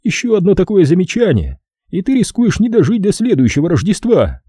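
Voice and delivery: ordinary delivery, nothing marked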